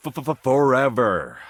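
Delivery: high, slow stuttered taunt; mocking stutter. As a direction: stutter the first sound, then drag the word out high. Mock stutter on repeated word.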